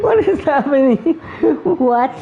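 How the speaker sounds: loud, high disbelieving question; baffled. Real, not acted. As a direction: ask it in real disbelief. Streamer baffled by game scene.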